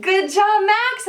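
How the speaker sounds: put-on high, bright praise for a child; cheerful praise. Shown as a demonstration. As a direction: praise the kid with big enthusiasm. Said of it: "We're saying".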